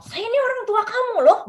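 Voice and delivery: high, loud, fast mock parent scolding. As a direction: lord it over the kid like a bossy parent. Mimicking authoritarian parent scolding child. (meniru orang tua yang memarahi anak, tinggi dan keras)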